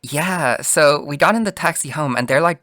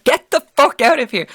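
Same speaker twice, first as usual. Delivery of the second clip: high, loud mock yell; retold yell; 13 semitones higher, 5 dB louder than usual. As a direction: re-enact shouting him away, voice pitched up. Friends ordering the man away.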